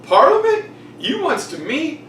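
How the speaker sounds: high, bubbly impression of a carefree king; party-boy king. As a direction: ham up a giddy king hyping his boys. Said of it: "And he says".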